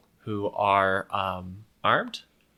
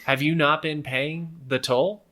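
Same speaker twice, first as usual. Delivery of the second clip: higher-pitched roadside swindler, politely questioning; toll collector explaining; 6 semitones higher than usual. Acted. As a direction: explain it like an official toll-taker. Game master plays toll collector.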